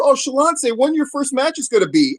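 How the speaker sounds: put-on happy babyface. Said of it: "the baby face is happy for you too".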